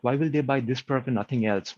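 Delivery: usual speaking voice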